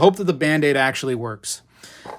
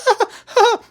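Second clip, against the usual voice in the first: put-on much higher, louder yelping cries like a scared kid; high yelping "ah, ah" as a bitten kid; imitated crying yelps; 6 dB louder than usual. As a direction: yelp out in mock childish pain.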